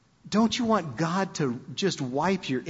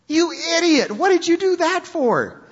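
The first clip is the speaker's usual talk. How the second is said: higher, louder mock outrage; exasperated sailors; 12 semitones higher, 5 dB louder than usual. Sailors scolding Jonah, higher and louder in mock outrage. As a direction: chew him out in disbelief. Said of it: "And they said".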